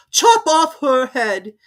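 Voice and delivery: high, slow, imperious lady; grand and dismissive; Duchess. A narrator's reading. Duchess figure voice.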